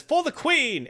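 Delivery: high patriotic last cry; a doomed Briton going out proudly; heroic cry. Retold or imitated. British explorer defying the cannibals, high heroic cry.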